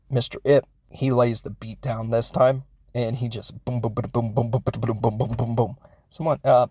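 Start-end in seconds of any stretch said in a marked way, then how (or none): 2.9-5.8 soft repeated mouth-made booms like blows landing; a run of quiet imitated punch booms; punch sound effects. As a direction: beat out the fight with your voice. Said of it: "That's just me going boom".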